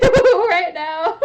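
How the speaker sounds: loud wee-woo dissolving into laughter; laughing siren. Retold or imitated. Retail brain alarm ringing, loud and laughing. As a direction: laugh through the alarm noise. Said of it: "my retail brain, like, is going wee-woo".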